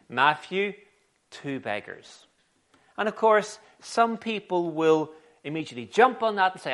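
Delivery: usual speaking voice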